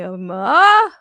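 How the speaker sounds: "ma" swooped up too far and loud; mock student pushing "ma" loudly upward; freaked-out tone overshoot. Teacher imitates students freaked out at tone's end, loud overshooting swoop. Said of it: "you're gonna get freaked out".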